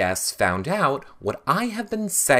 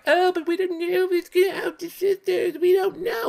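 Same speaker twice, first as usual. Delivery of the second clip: much higher, put-on simpering voice; mock their excuses in a silly falsetto; whiny high mockery. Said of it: "everyone's like".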